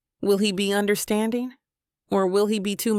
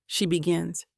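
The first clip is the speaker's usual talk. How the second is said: usual speaking voice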